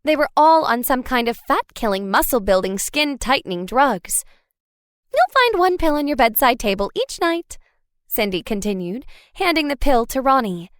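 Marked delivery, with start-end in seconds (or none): 5.1-7.6 selling it like a resort hostess; upbeat pitch, rising at the end; cheerful hostess. A narrator's reading. Cindy explains the pill.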